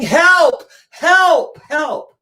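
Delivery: high loud cries for help; calling for help. Retold or imitated. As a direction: shout to the skies.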